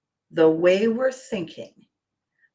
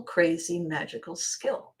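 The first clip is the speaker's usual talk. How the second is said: ordinary talk, nothing marked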